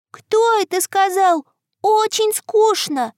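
put-on little girl voice. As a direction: ask it like a curious little girl. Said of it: "спрашивает девочка".

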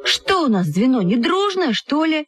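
put-on very high, insistent childish protest; exclaiming. Girls insist their group is friendly, exclaiming. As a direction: insist on it, stretching the last words high. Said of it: "воскликнули мы с Люськой в один голос".

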